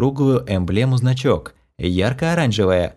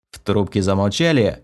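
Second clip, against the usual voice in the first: plain reading voice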